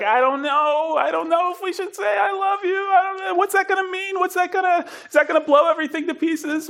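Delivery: high, nervous worried voice; squeaky panicked dithering; anxious fretting. Retold or imitated. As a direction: fret high and panicky over the words. Anxious partner fretting over saying it.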